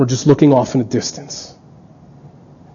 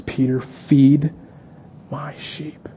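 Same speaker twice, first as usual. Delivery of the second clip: slow, fading to a hushed whisper; 9 dB quieter, much slower than usual. Acted. Jesus telling Peter to feed his sheep, whispered. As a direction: breathe the last words tenderly.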